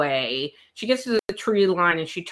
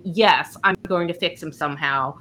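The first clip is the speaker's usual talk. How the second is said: ordinary talk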